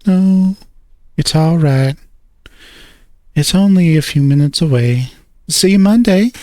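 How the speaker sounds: a gently voiced girlfriend; young woman voice. A narrator's reading. Girlfriend's parting lines, higher voice.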